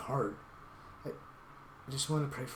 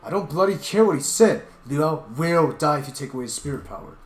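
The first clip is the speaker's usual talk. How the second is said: much louder and higher, shouting in rage; a raging outburst at a foolish friend; furious yelling; 3 semitones higher, 11 dB louder than usual. Acted. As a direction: scream at him to open his eyes. Scolding the leopard furiously.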